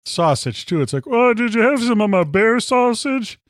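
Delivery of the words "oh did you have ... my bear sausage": high, bright put-on voice of a hunter; proud hunter voice. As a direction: squeak it out like a proud host. Mimics a proud hunter friend.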